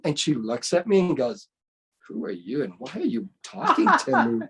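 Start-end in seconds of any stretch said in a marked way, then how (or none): 2.1-4.5 mock-disdainful questioning; imitating a haughty brush-off. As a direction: act out a who-do-you-think-you-are look. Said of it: "kind of look, right?".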